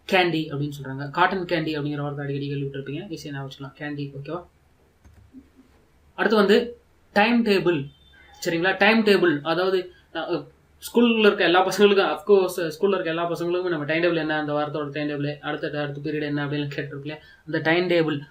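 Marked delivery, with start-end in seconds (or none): none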